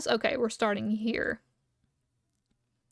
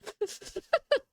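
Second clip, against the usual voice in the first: high squealing laugh; 10 semitones higher than usual. Real, not acted. Laughing at own mistake.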